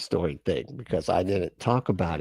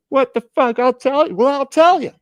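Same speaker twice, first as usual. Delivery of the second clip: hammed-up angry shout, much higher and louder; mock furious yell; 8 dB louder than usual. Balaam snapping back at his talking donkey, hammed-up high furious yell. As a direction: blurt it out like a man too mad to think. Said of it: "'cause he was just so mad that he's like".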